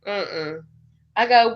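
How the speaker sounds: ordinary delivery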